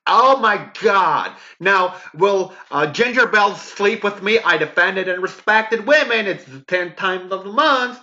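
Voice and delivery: mocking the commenter.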